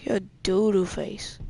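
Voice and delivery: ordinary talk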